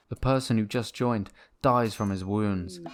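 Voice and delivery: ordinary talk, nothing marked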